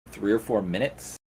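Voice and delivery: usual speaking voice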